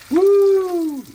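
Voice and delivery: high, loud whoop of delight; excited whoop. Real, not acted. Cook thrilled at the fried cutlets, loud whoop. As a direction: cheer at the golden cutlets.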